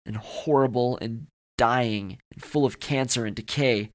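usual speaking voice

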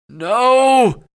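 high, drawn-out loud scream. A narrator's reading. Chance objecting to the two kissing, screaming. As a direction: scream it out in protest. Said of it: "Chance screamed".

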